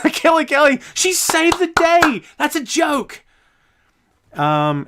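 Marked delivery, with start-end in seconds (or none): 0.1-3.2 high, slow, sneering mock praise, laughing into it. Host mocking a wrestler signing, in a high sneering voice. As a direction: sarcastically gush over the signing.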